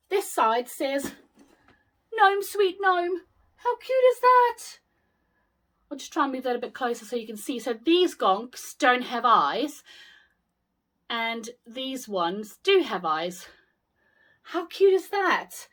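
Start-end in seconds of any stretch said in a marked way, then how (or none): 2.1-4.6 much higher and louder, a squeal of delight; gushing delight. Real, not acted. Gushing over gnome decoration. 14.5-15.7 high, bright coo of delight; gushing delight. Real, not acted. Gushing over gonks.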